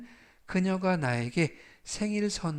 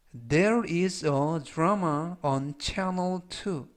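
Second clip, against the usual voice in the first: very slow, word by word English; slow model reading; much slower than usual. Shown as a demonstration. Teacher repeating a sentence slowly for students to copy. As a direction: spell it out in sound, slowly.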